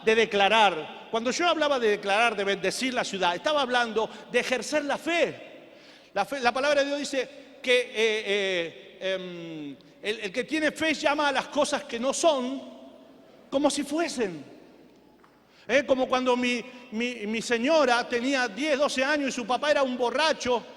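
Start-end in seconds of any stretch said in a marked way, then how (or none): none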